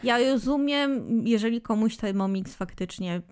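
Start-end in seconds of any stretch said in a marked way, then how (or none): none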